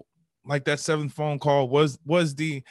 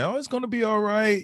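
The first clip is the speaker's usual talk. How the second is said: high, breezy mock comfort; hammed-up "everyone" consoling; imitated reassurance; 9 semitones higher, 3 dB louder than usual. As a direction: wave the worry away. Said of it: "Everyone was just saying".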